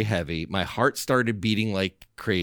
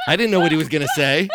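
real laugh through the words, louder and quick; 7 semitones higher, 7 dB louder, faster than usual. Real, not acted. Telling the host the twist, laughing out loud. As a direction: laugh it out, higher and faster.

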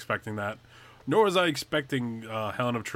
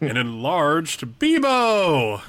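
dramatic reveal; 3 semitones higher, 5 dB louder, much slower than usual. Pretend. Dramatic reveal of final twist.

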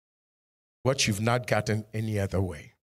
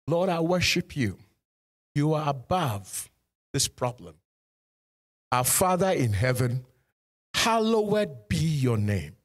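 spoken prayer. Acted. Leads a prayer aloud.

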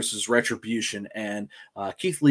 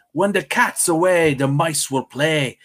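mock Irish accent; 4 semitones higher, slower than usual. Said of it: "with your Irish accent".

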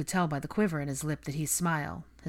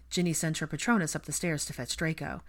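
ordinary delivery, nothing marked

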